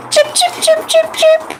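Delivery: very high chirping; bird chirps. Acted. Birds eating the blueberries.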